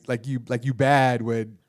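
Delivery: usual speaking voice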